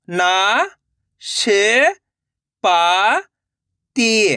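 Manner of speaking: ना, श, पा, ती one by one, held long; direct-matra reading. Shown as a demonstration. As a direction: sound out ना, श, पा, ती with pauses. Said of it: "direct मात्रा का उच्चारण करके". (ना, श, पा, ती अलग-अलग खींचकर)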